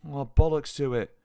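loud, slow, dismissive shout. A narrator's reading. Friend walking away shouts back to John, slow loud dismissive shout. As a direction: fling it back over her shoulder. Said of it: "she shouted over her shoulder".

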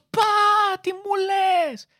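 high, slow tragic exclamation; dramatic shock. Pretend. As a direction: play it as a Greek tragedy. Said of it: "Χτυπάς το πόδι".

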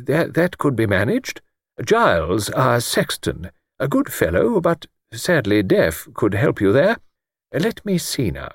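put-on high, gentle voice of a stooping old clergyman; mild old vicar. Old vicar answering Luke, gently pitched high.

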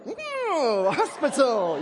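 high, loud gushing "Oh, hospital"; mock cheery reply. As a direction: fake brightness at bad news. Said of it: "we're like".

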